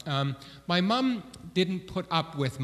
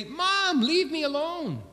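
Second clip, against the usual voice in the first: put-on much higher whiny child voice; whiny kid; 12 semitones higher than usual. Children imagine whining at their mom, high whiny child. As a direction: whine it like a sulky kid.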